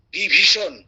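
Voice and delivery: "বিভীষণ" loud and crisp, every sound clear; crisp pronunciation. Shown as a demonstration. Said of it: "উচ্চারণগুলো লক্ষ্য করুন".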